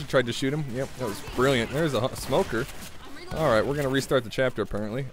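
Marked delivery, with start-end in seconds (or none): none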